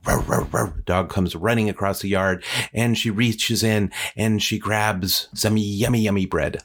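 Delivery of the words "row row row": imitated dog barks; repeated mock woofs from a storyteller; dog barking. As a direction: bark like a charging dog. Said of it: "here comes the dog".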